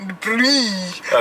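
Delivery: Dupre with an exaggerated rolled R; mock rolled R. Wife mocking how husband says Dupre, rolled R. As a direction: mimic him trilling the R in Dupre. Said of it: "you be rolling your R's".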